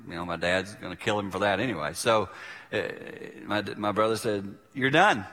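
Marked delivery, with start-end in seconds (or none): 4.7-5.2 higher, louder, putting his foot down; firm retold ultimatum; retold confrontation. Brother standing up to dad, firm.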